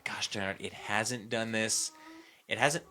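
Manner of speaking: normal talking voice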